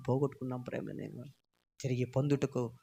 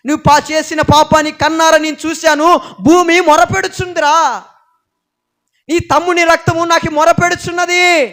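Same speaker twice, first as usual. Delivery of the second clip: loud, high furious condemnation; God's angry rebuke; 12 semitones higher, 17 dB louder than usual. Acted. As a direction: thunder the judgment at the murderer. God rebukes Cain.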